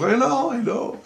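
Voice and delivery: mimicked child, very high pitch; high-pitched retold excitement; excited little girl. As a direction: imitate a little girl eagerly agreeing. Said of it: "she was all excited".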